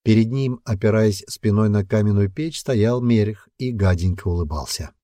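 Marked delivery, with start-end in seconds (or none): none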